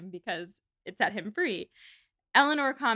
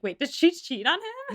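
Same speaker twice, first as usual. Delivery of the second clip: high, startled question; suddenly catching on to the scandal; shocked realization; 8 semitones higher than usual. Retold or imitated. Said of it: "in my notes I was like".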